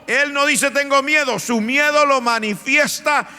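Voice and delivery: loud, fervent sermon delivery; impassioned pulpit preaching; full-force preaching. Acted. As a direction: preach it with fire to the congregation.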